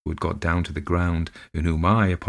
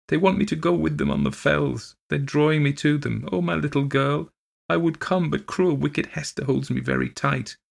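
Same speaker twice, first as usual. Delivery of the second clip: put-on high, pleading child near tears; sobbing plea; 9 semitones higher than usual. Fainting girl pleads with her nurse, high tearful plea. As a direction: strain against the arms holding you, pitched high. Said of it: "writhed in my arms and sobbed".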